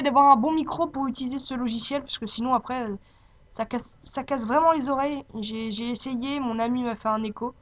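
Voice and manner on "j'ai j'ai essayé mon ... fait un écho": ordinary talk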